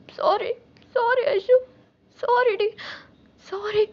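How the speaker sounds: high, loud, drawn-out tearful sorry; slow, anguished repeated apology; crying apology. Acted. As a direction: beg forgiveness through tears. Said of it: "எதுக்கு அழுகுற?".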